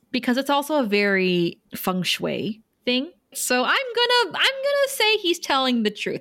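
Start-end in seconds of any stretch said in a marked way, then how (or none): 3.3-6.2 bursting out high with an eager verdict; excited. Real, not acted. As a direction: announce it with a rush of enthusiasm. Excited verdict on a plot point.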